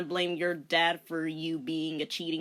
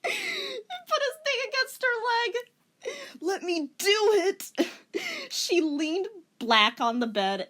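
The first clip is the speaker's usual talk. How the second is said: very high, embarrassed squeak; mock high-pitched reading; squeaky giggling voice; 13 semitones higher than usual. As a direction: squeak through the awkward bits. Reader squeaks through lewd part.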